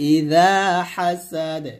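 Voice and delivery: ইযা হাসাদ slow, final দ bounced with an echo; slow recitation with qalqala on হাসাদ; qalqala on dal. Shown as a demonstration. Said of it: "এ দালটা কলকল হবে".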